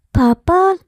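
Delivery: put-on high childlike voice, a touch of worry rising at the end; a small child calling her father, slightly anxious; worried little child. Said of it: "tila nag-aalalang banggit". (batang may kaunting pag-aalala, matinis na boses)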